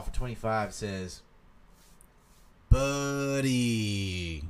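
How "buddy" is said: long, stretched-out word sliding down; drawn-out call. Retold or imitated. Streamer reading Sal's paid message, drawn-out call.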